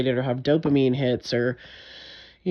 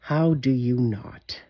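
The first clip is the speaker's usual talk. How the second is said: pointed retort; 2 semitones lower, 4 dB quieter, slower than usual. Retold or imitated. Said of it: "I will say to you then".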